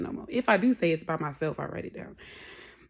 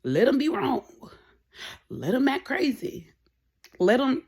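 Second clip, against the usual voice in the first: louder, higher exasperated sigh of giving up; fed-up, louder let-it-go; 6 semitones higher, 5 dB louder than usual. Retold or imitated. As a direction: throw up your hands, let them be. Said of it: "just say".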